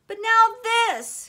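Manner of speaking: high, loud outburst; yelling. A narrator's reading. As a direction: yell it in outrage, pitch jumping up. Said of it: "he yelled".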